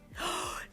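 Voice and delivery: gasp. A narrator's reading. Thrilled gasp.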